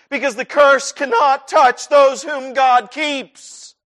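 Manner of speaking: full-force preaching; preaching climax. Acted. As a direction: build to a ringing proclamation. Sermon climax builds.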